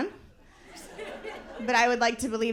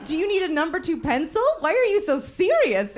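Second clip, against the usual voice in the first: slightly higher, playful questioning; lightly teasing; 8 semitones higher than usual. Retold or imitated. Talking to a serious-looking vein, playful teasing. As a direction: tease the vein gently. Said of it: "I'm like".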